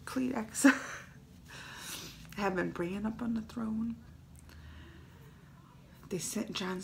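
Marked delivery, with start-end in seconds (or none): 2.4-4.0 whispered through a laugh, much softer; laugh-cry it under your breath; teary laughing whisper. Real, not acted. Fan tearfully laughing about Bran on the throne. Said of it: "I should have had more Kleenex".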